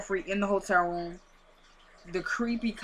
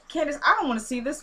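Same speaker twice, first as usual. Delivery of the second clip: pitched up, quick and put out; a brother pushing the photo away; exasperated Benny; 6 semitones higher, faster than usual. Retold or imitated. Brother refusing sister's photo, pitched-up exasperated voice.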